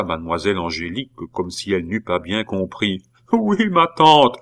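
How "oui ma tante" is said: high, slow, falling wail; sobbing reply. A narrator's reading. Nephew admitting it to his aunt, sobbing. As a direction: answer in a loud acted boo-hoo. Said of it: "les sanglots de Pitou redoublèrent".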